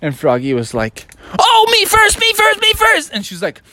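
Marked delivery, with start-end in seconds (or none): 1.4-3.1 very high, loud, excited squeal; clamor to be picked first; eager pupil. Acted. Froggy to teacher about summer vacation, eager high squeal.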